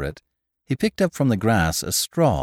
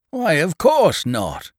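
crying out; 7 semitones higher, 4 dB louder, slower than usual. Acted. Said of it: "cried the farmer".

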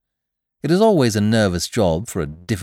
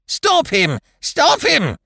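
much higher, loud shriek rising; piercing cry; 4 dB louder, much slower than usual. A narrator's reading. Old aunt shrieking to save her cat from the guest. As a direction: scream it out in alarm. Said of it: "the exhibit uttered a piercing cry".

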